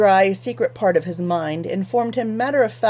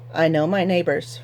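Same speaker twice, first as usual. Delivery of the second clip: usual speaking voice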